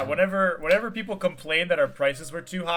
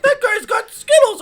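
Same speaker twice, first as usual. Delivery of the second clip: high-pitched, loud boss impression; high mock boss voice; 10 dB louder than usual. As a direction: squeal it like a tattling boss.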